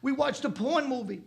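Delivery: put-on high, loud sheepish kid voice; caught kid. Son after the robot slap, high and loud. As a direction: play the slapped kid giving in. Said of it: "He goes".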